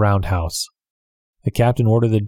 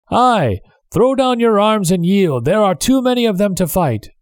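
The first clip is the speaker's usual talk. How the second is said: calling out; 10 semitones higher than usual. Acted. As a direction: urge them to surrender from afar. Captive calls to mutineers.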